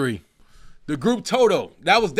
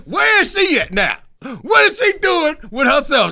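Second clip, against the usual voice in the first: loud, high laughing questions; cracking up; 10 semitones higher, 4 dB louder than usual. Real, not acted. Joking about the diss singer, laughing loudly. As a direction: laugh through the words.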